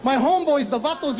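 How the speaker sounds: ordinary delivery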